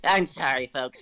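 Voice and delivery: ordinary talk, nothing marked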